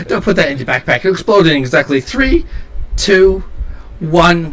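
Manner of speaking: high, loud countdown in character; gleeful mad inventor. Acted. Shopkeeper warning the nut will explode, high gleeful countdown. Game master voices eccentric shopkeeper.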